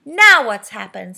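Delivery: loud, slow, annoyed demand; most annoyed. A narrator's reading. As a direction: snap it out, really put out. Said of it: "in a most annoyed voice".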